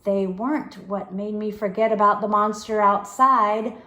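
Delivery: ordinary delivery, nothing marked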